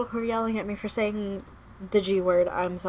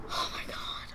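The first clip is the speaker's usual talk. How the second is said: hushed, stunned whisper; voiceless, soft exclamation; overcome whisper; 9 dB quieter than usual. Real, not acted. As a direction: whisper it, emotionally spent. Overcome after the scene.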